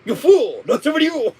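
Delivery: high yelping hammy scientist; Hojo voice. Acted. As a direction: squawk it like a cartoon villain. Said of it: "bad Hojo performance".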